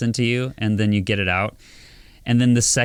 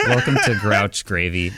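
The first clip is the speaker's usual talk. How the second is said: laughing intro. Shown as a demonstration. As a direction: restart the welcome while cracking up.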